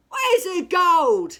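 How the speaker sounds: very high, very loud, slow demand; a pirate captain bellowing at the Troll; angry shout. A narrator's reading. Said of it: "shouted Hank Chief".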